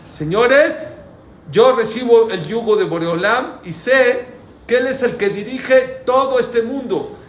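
high, loud, emphatic sermon delivery; impassioned preaching. Real, not acted. Rabbi tells listeners that God runs the world, impassioned preaching. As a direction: proclaim it to the whole room.

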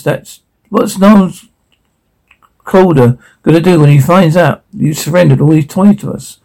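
ordinary talk

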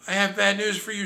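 high, loud urgent call. Acted. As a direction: call out a warning. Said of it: "she called".

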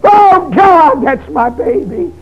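mother weeping. Retold or imitated. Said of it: "my mama weeping out loud".

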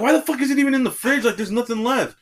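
high, incredulous complaint; retold exasperation. Worker challenging supervisor over the near-empty jug, exasperated.

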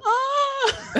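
high piercing faked cry of pain; mock porn shriek. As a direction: shriek it like a hammed-up porn actress. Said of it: "And she's like".

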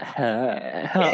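lightly mocked tongue-out panting noise; Lauren impression. Mocking Lauren's open mouth to co-host, tongue-out impression. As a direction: pant it as if the tongue hangs out. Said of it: "always with her tongue out".

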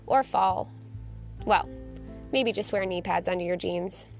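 ordinary talk

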